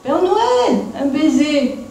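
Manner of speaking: high, loud call across the workshop; a witch yelling across the room for a kiss. Acted. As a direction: shout it up high to be heard far off. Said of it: "elle crie".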